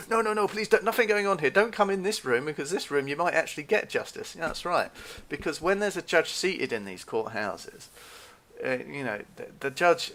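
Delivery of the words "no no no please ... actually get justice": high, loud, hammed-up protest; mock pleading. Desk guy keeping visitor out of the courtroom, mock pleading. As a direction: imitate a flustered guard begging someone off. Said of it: "very much there trying to say".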